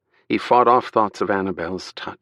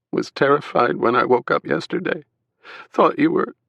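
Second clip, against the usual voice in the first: sobbing; 7 semitones higher than usual. A narrator's reading. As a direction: voice cracking, higher. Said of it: "She was sobbing, her voice cracked".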